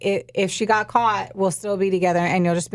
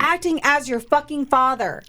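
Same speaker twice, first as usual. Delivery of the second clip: raised, disgusted outrage; outraged; 6 semitones higher than usual. Real, not acted. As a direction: spit it out in disgust. Outraged at the predator.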